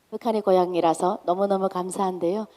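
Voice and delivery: usual speaking voice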